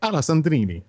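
exaggerated Italian accent, sung out. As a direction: roll it like an Italian. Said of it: "like, an Italian accent".

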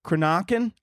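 "Cranachan" as cruh-NOCK-en, questioning; unsure pronunciation. Shown as a demonstration. Reader guessing at a dessert name, "Cranachan" as cruh-NOCK-en. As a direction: stress an AH middle syllable in "Cranachan". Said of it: "I don't fucking know".

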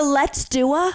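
mock customer voice; imitating customer. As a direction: play back her phrase.

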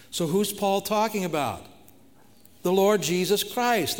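high, loud call-and-answer preaching; preaching at full force. Acted. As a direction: ask it out, then proclaim the answer. Preacher asks and answers loudly.

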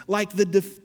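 ordinary delivery

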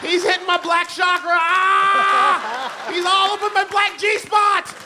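high, loud ecstatic cries; ecstatic shout. Retold or imitated. Comic recalling watching eulogy, ecstatic high shouting. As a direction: shout like the spirit hit you.

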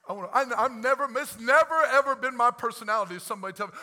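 loud, fast, fired-up pastor; full-force preaching. Acted. Pastor pours out his heart to the congregation, fired-up preaching. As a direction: preach it hard and let it build.

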